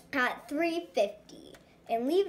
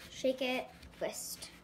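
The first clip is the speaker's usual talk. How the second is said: ordinary talk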